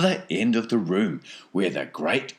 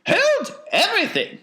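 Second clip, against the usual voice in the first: very high falsetto call; commanding Wonka; 6 dB louder than usual. A narrator's reading. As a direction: halt everyone in a piping eccentric voice. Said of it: "said Mister Wonka".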